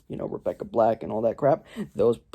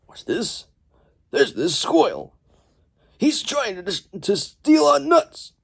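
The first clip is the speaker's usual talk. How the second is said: villain voice; 9 semitones higher than usual. Retold or imitated. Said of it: "the bad guys are like".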